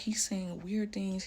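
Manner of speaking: normal talking voice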